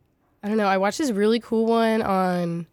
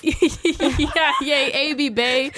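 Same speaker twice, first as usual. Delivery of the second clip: laughing; 6 semitones higher, 3 dB louder, much slower than usual. Pretend. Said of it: "say it in Pig Latin".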